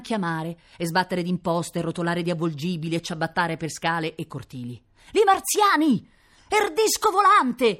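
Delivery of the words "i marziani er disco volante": high, slow, loud Roman crowd cries; startled neighbours shouting out; excited shouting. Acted. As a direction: yell it up at the sky. Crowd yelling at the sky.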